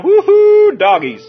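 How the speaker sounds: high, loud whoop dropping onto the last word; mock smitten whoop. Preacher playing a smitten South Pomona guy, whooping. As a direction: let out a hammed-up holler, knocked out by her. Street reaction to being smitten.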